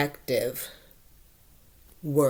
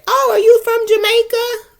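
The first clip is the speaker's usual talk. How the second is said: high, loud, eager mock question; old blunt question; 10 dB louder than usual. She mocks her old habit of asking about Jamaica, high and eager. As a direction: chirp it out as if thrilled to guess. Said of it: "I used to say".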